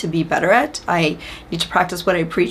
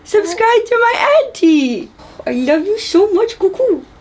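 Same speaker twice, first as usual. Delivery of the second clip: very high, slow, louder cooing; 5 dB louder, slower than usual. Real, not acted. Aunt telling niece to say subscribe, slow high baby talk. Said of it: "Please ignore my baby voice".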